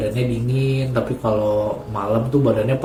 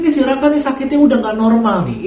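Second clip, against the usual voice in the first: high, loud urgent pleading; mother urgently phoning her son; frantic phone call; 12 semitones higher, 4 dB louder than usual. Retold or imitated. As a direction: rush it out in worry.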